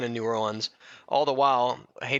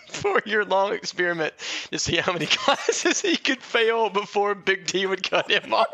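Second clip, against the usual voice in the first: words breaking up with real laughter; reading through giggles, pitch lifted; laughing; 8 semitones higher, 4 dB louder than usual. Real, not acted. Laughing while reading his own college failures aloud.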